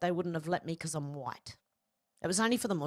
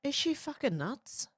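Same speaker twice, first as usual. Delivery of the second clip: incredulous outrage; 6 semitones higher than usual. Real, not acted. Outraged reaction to clip.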